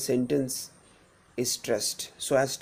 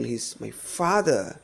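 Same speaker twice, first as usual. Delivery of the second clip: single out "father"; quick lead-in, then emphatic "father"; stress on FATHER; 3 semitones lower than usual. Shown as a demonstration. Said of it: "If we emphasize this particular word".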